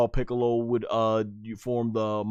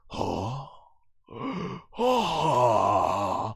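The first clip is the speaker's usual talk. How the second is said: shocked Vegeta; 2 semitones lower, 3 dB quieter than usual. Retold or imitated. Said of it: "He's like".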